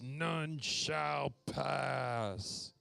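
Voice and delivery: very slow, solemn warrior voice; grim gatekeeper voice. Retold or imitated. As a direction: intone it like a guard blocking a bridge. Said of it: "Doug says".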